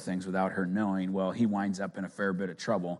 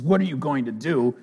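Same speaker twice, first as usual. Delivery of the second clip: rising to a shout, higher and louder; Edna; 6 semitones higher, 14 dB louder than usual. Retold or imitated. Edna scolding crying Elastigirl, building to a shout. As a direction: build to a bossy yell, slowing at the end. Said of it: "She says".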